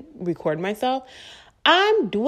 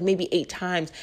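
ordinary delivery

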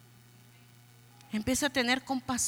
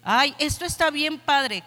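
ordinary talk

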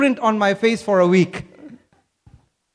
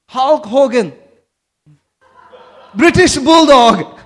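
loud, drawn-out ring-announcer call; wrestling announcer; 4 dB louder, slower than usual. Pretend. Preacher recalling wrestling watched with dad, playful announcer call. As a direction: stretch it out like a playful wrestling introduction.

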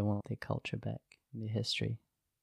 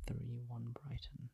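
soft unvoiced whisper; 12 dB quieter, slower than usual. Real, not acted. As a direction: repeat it under your breath. Repeating prediction in whisper.

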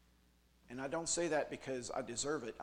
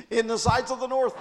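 much higher and louder each boast; climbing higher; 9 semitones higher, 14 dB louder than usual. Acted. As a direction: push the pitch up like climbing stairs.

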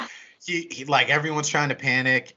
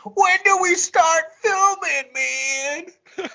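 high, slow goofy voice of a clueless fan; crazy audience guy; 5 dB louder, much slower than usual. Retold or imitated. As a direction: play the oblivious fan, pitched way up. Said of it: "that one crazy guy in the audience that goes".

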